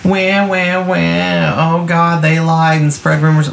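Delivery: high whiny mock crybaby voice; mocking whiner. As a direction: whine it like a sulking child, mocking them. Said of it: "the one side will be like".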